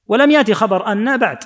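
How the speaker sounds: normal talking voice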